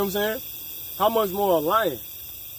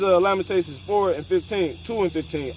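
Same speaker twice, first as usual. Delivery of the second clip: ordinary delivery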